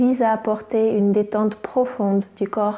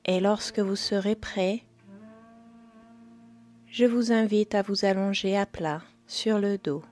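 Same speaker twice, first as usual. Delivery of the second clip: guided meditation; 9 dB quieter than usual. Acted. Yoga teacher guiding listeners into yoga nidra, calm meditation voice.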